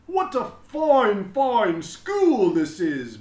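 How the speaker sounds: high, loud and slow, booming with pride; a beaming principal proclaiming to everyone; proud headmaster announcing. A narrator's reading. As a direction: announce it grandly to the whole school. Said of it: "Mister Keene said".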